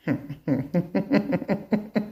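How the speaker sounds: a soft run of giggles; laughing. Real, not acted. Woman retelling a frightened mother's story, laughing softly. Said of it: "I'm sorry that I'm laughing".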